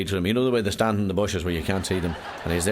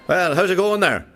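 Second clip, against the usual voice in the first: cheery loud call; 9 semitones higher, 6 dB louder, slower than usual. Acted. Stranger calls from next cubicle.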